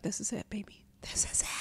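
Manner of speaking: hushed whisper, barely voiced; whispered hope. Recalling Tinder guy mentioning therapy, whispered hope. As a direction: breathe it like a secret hope.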